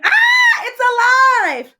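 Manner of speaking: very high, loud, slow shriek of fright; terrified scream. Acted. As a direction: scream in horror. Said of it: "The man screamed".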